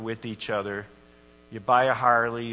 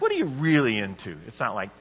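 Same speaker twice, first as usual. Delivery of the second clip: usual speaking voice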